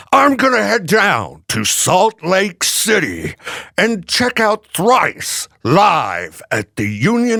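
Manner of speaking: slow, drawn-out reporter voice; news guy voice. Pretend. Reporter meeting someone at a party, slow news voice. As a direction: play the reporter introducing himself. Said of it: "why are you talking that way?".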